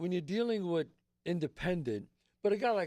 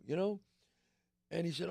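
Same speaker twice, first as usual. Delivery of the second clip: ordinary talk, nothing marked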